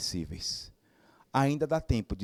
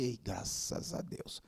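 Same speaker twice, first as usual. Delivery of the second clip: slow breathless whisper of relief; relieved whisper; 3 semitones lower, 12 dB quieter, slower than usual. Retold or imitated. Speaker recalls his relief as the old friends reconcile, slow whisper. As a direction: breathe it out softly, thanking God.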